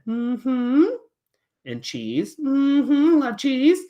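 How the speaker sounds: high, eager kitty agreeing; puppet cat voice. Acted. Cat puppet agrees about cheese, high and eager. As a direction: hum along brightly as the cat. Fluffy chimes in.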